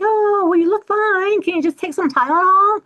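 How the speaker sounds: dismissive mock. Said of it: "they're like".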